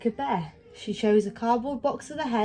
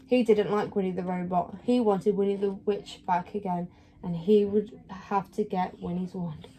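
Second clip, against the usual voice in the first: ordinary delivery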